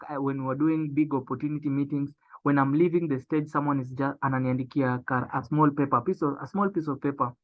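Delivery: ordinary delivery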